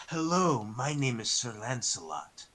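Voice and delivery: low, quiet brooding edgy hero; deep cool loner voice, half under the breath; Shadow impression. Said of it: "really good Shadow impression".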